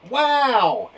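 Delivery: loud, high wow; surprise. Shown as a demonstration. Teacher showing exclamation marks for surprise, amazed and loud. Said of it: "used as surprise or excitement".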